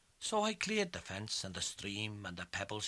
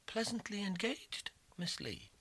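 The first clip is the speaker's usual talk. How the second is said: high mocking genteel voice; sly lawyer; 10 semitones higher than usual. Lawyer greeting Betsy, high and smugly polite. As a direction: sneer it politely like a smug lawyer. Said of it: "and says he".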